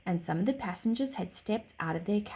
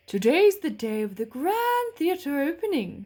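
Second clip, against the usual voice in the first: horse voice; 6 semitones higher, 6 dB louder than usual. A narrator's reading. Horse character voice.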